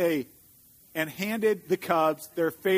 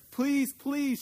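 high-pitched plea without real volume; mock shout of begging cubs; pleading shout; 7 semitones higher than usual. A narrator's reading. Cubs begging in the parking lot, mildly shouted plea. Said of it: "shouted the cubs so loudly".

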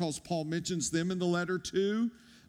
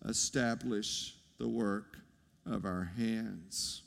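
"establish the work of our hands" repeated lower and much softer, slowing on "of our hands"; 9 semitones lower, 6 dB quieter than usual. Shown as a demonstration. Pastor lingers on the verse for the congregation, hushed and slow. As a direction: let "establish the work of our hands" sink low and fade.

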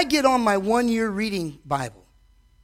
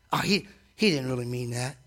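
lower, brushing it off; dismissive scoff; 9 semitones lower, 5 dB quieter than usual. Retold or imitated. Preacher imitates people mocking God's word, dismissive. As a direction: wave it away lightly.

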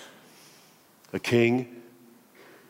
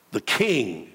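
ordinary delivery

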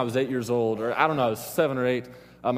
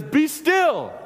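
high, forceful command to the waves; dramatic acted shout, drawn high; rebuking shout; 13 semitones higher, 4 dB louder than usual. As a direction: order the storm down at the top of your voice. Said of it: "he rebuked the wind".

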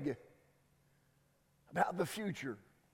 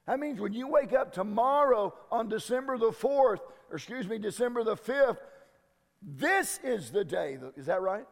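usual speaking voice

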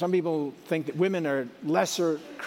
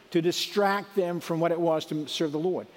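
ordinary delivery

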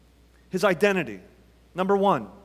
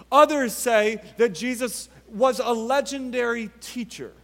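ordinary delivery

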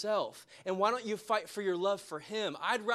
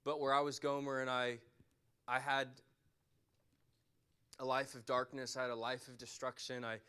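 softer, lower, intimate preaching; quiet altar call; 7 semitones lower, 3 dB quieter than usual. Acted. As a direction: draw them in gently as the music starts. Reflective altar call, worship team joins.

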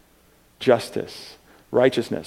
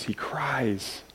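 whispered; 12 dB quieter than usual. Acted. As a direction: whisper it, almost no voice. Preacher dwells on Jesus weeping.

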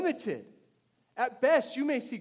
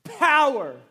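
"Power" shouted high and loud, falling away; explosive emphasis; 9 semitones higher, 15 dB louder than usual. Shown as a demonstration. Pastor marking the key word for church, explosive "Power". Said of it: "Read it with that emphasis".

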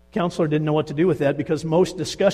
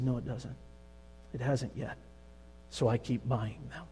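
much softer, a quiet confession; soft, sad admission; 2 semitones lower, 10 dB quieter than usual. Acted. Wife admits to the counselor that nothing helps, very soft. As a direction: let it drop to almost nothing.

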